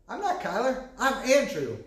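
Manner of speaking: a lightly voiced young son in a mask; imitating his son. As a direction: play the kid insisting on a new name. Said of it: "He goes".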